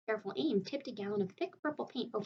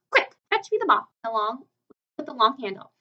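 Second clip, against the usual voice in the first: higher, louder commanding shout; bossy yell; 3 semitones higher, 11 dB louder than usual. Retold or imitated. Spider voices Aunt Sponge shouting for the long mop. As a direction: bark for the mop.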